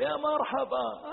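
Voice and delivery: high, drawn-out hearty hello; jovial greeting. Acted. Young man answers his friend's call, jovial. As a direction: greet a buddy with big welcome. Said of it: "ويضحك".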